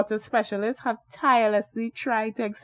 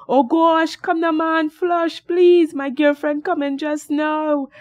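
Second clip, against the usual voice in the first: panicked scream; 8 semitones higher, 6 dB louder than usual. Acted. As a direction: scream it high and frantic, begging.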